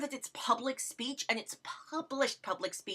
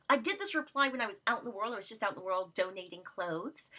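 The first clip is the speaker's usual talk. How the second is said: ordinary talk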